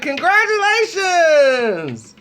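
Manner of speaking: stretched congratulating cry; congratulations. Retold or imitated. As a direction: cheer her on warmly and slow. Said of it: "I'm like".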